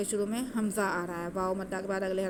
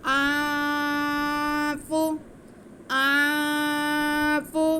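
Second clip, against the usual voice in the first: slow, drawn-out melodic tajweed recitation, higher and louder; Quran recitation; 5 semitones higher, 5 dB louder, much slower than usual. Shown as a demonstration. Teacher demonstrating the recitation to students, slow melodic chant. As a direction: chant it slowly with nasal ghunna. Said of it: "नाक में छुपा के गुन्ना करते हुए".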